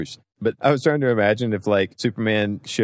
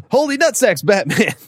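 high, loud golly-gee Robin; hammed-up sidekick yelp, much higher; 13 semitones higher, 5 dB louder than usual. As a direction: squeal it like a panicked boy wonder. Robin voiced in Batman parody.

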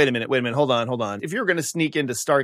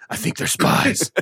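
breathy secret whisper; hushed whisper; 4 semitones higher, slower than usual. Retold or imitated. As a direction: lean in and whisper the suspicion. Brad whispering to buddies.

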